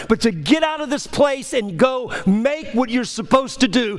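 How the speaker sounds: high, pressing altar call; full-force preaching over music; impassioned preaching. Acted. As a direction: urge them to the altar.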